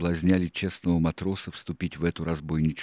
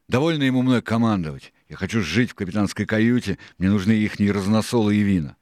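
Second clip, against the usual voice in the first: louder, higher mutinous complaint; raised-voice pirate rant; impatient boatswain Hands; 4 semitones higher, 7 dB louder than usual. A narrator's reading. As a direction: grumble loudly like a fed-up sailor.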